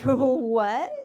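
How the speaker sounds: a shocked, disbelieving "What?"; stunned what. Retold or imitated. Recalling the therapist's advice, a shocked blurt. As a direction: caught off guard and blurting it out. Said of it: "And I was like".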